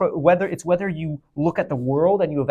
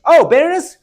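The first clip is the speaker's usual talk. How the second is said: high, louder acted surprise; mock realization; 10 semitones higher, 5 dB louder than usual. Teacher acting out someone looking at the world, mock surprise. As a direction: play a sudden discovery, pitched well up. Acts out noticing the middle-grade land.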